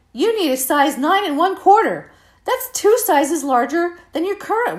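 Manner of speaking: loud, high-pitched announcement for all to hear; shockingly loud exclamation. Retold or imitated. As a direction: blurt it out far too loudly, pitched high. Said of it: "exclaimed in a shockingly loud voice".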